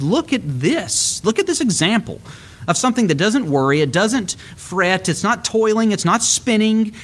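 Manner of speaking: a preacher voicing Jesus in wonder; marvelling. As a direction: point out the lilies in amazement. Jesus pointing to the lilies.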